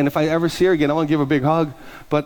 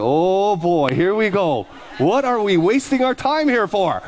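slow, higher, dragged-out dread; exasperated; 5 semitones higher, slower than usual. Retold or imitated. Pastor's inner groan at lunch, slow and higher. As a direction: sigh through it like here we go again.

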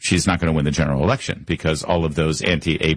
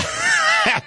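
short high burst of laughter; amused laugh. Real, not acted. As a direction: laugh at the clip you just played. Host reacts to viral clip.

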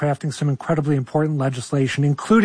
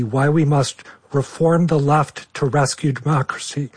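ordinary talk

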